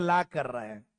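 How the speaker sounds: ला said low with a clipped UP vowel for क्या; UP accent. Retold or imitated. Teacher imitating UP boys saying ला, low UP accent. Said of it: "UP वाले बोलते हैं".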